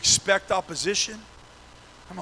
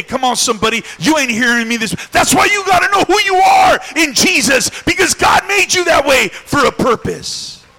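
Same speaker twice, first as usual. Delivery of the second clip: high, shouted sermon climax; full-force preaching; 7 semitones higher, 9 dB louder than usual. Real, not acted. Preacher urges church to know who they are, shouted preaching. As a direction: drive it home to the congregation.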